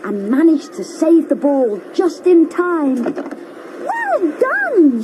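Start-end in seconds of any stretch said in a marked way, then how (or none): 3.9-5.0 put-on high, cheering boy's voice; child shouting praise. A narrator's reading. Joe cheering Brum after the save, high and bright. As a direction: call out well done like a happy kid. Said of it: "shouted Joe".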